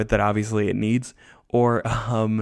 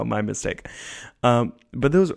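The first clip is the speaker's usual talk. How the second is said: ordinary talk, nothing marked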